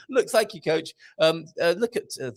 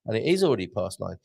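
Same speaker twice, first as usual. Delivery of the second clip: ordinary delivery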